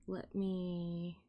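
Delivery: normal talking voice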